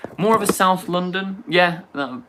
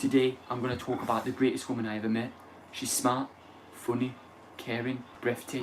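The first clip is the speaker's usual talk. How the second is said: Yaz impression; 3 semitones lower, 8 dB quieter, faster than usual. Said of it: "Yaz talks like".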